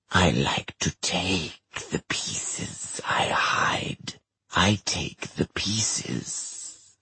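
slow, unvoiced creepy whisper; eerie demon whisper. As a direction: taunt them from the shadows.

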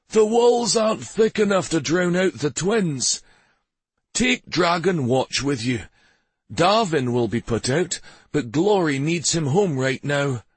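lightly voiced king, pitched high; amused, advising king. A narrator's reading. King advising a departing traveller, amused. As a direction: start high and amused, then settle into advice.

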